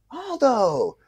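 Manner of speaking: high, drawn-out name call, falling at the end. Retold or imitated. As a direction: call after someone, high. Calling Aldo a second time.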